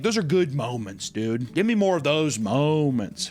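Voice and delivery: ordinary delivery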